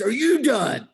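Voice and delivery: high, very loud imitation of a child; tearful yell, imitated. Son to dad while fighting back tears, yelling. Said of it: "he's fighting back tears".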